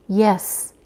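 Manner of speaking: soft, subdued yes; a quiet, hushed reply; quietly. A narrator's reading. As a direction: answer softly, longing held back. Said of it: "she said quietly".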